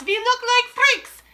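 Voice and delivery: high, loud, slow wail; child shouting in horror. A narrator's reading. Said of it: "Another shouts".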